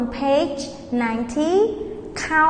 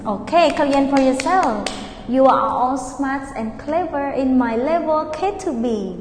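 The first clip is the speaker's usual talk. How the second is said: normal talking voice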